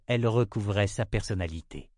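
usual speaking voice

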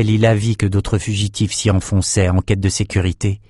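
ordinary delivery, nothing marked

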